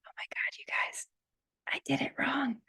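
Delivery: whispered, half laughing at herself; embarrassed whisper. Real, not acted. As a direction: confess the slip under the breath. Catches her own mistake.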